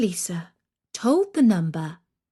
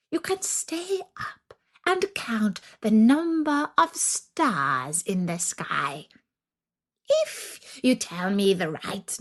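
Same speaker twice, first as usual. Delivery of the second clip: a hungry witch savouring her threat, hushed then sharp. Acted. Baba Yaga sets the test.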